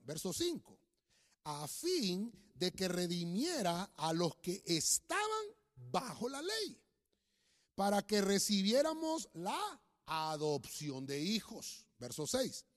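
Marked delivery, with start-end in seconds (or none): 1.4-11.9 slow, declaimed Bible verse; solemn, emphatic scripture reading; preacher reading scripture. Real, not acted. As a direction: stretch key words and pause between phrases. Reads scripture aloud to congregation.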